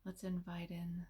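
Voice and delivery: soft, unhurried meditation voice with long pauses; hushed, soothing delivery, leaving space between phrases; guided meditation. Real, not acted.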